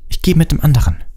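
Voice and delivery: put-on high, cross girl voice; high-pitched exasperation; angry snapping. A narrator's reading. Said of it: "fauchte Hermine zornig".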